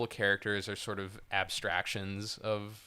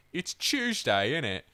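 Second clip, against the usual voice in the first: exaggerated street-British impression; 4 semitones higher than usual. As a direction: caricature a British person, drawing out the first words. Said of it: "What does a British person sound like?".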